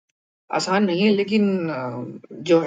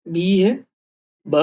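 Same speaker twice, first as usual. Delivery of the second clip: normal talking voice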